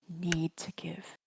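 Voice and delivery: ordinary talk, nothing marked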